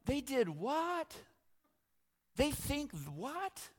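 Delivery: high, slow stunned disbelief; incredulous. Retold or imitated. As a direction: drag out the shock. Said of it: "You're like".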